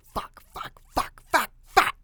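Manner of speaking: from a hushed curse building to a yell; frustrated cursing. Retold or imitated. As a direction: whisper rising to a loud shout of curses. Cursing at coming rain.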